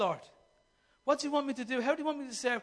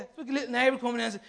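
normal talking voice

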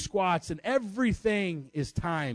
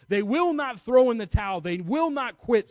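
higher, louder, stress on will not; rising preacher's vow; preaching emphasis; 7 semitones higher, 4 dB louder than usual. Acted. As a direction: hammer every not. Preacher builds to climax.

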